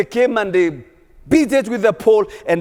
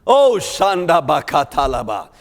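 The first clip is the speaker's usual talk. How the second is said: praying in tongues. Real, not acted.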